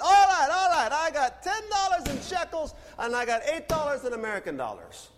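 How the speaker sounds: loud, high burst from an excited vendor; local man. Retold or imitated. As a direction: shout it high, then ease down. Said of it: "The man comes out".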